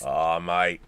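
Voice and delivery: put-on low, joking Australian accent; mock Australian accent. Host teasing after co-host didn't notice the accent, mock Australian. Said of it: "your fantastic Australian accent".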